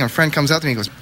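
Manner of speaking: ordinary talk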